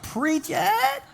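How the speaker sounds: high mock church shout-out; mock amen-corner call; congregation member. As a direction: chime in like an eager churchgoer. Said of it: "Some of you are like".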